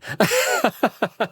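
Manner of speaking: high giggle; high laughter. Real, not acted. Laughing at own remark.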